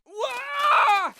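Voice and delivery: a loud, high-pitched squawk of surprise; a sudden shrill wah of alarm; startled high yelp. Acted. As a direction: yelp as you're picked up. Suddenly lifted off ground.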